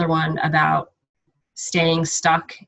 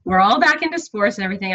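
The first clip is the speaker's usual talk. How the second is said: retold overwhelmed excuse; 4 semitones higher than usual. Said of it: "I was like".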